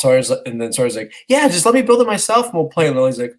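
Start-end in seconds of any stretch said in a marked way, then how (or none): 1.3-2.9 lightly voiced young boy, higher; a hint of an impatient child; conceding kid. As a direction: imitate a kid brushing off his sister. Son giving in to sister.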